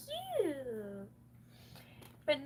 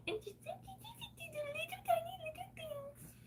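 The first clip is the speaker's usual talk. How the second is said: mocking whisper; 5 dB quieter than usual. Mocking tiny size.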